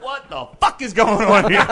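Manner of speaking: high, loud mock disbelief; astonished outburst. Storyteller seeing the rooftop party, astonished. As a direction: blurt it out in comic bewilderment.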